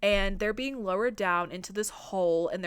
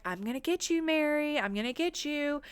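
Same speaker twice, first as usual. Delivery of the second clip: taunting sing-song; 6 semitones higher than usual. Retold or imitated. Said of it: "she's saying".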